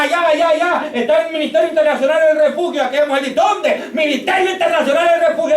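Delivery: impassioned, high-pitched preacher's delivery; fervent preaching. Real, not acted. Preacher rousing the congregation with stories of healing, fervent preaching.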